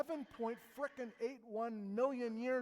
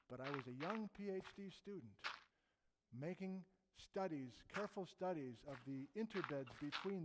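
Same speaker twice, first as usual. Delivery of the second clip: softer, lightly put-on German accent; 3 semitones lower, 7 dB quieter than usual. German volcanologist recalls his student fieldwork, light imitated German accent. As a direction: play an old professor with a mild German accent. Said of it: "this is my German accent now".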